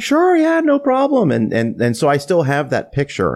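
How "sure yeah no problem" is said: very high, easy yes; re-enacted breezy consent; obliging agreement. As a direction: agree readily, pitched way up. Retells star agreeing to a picture.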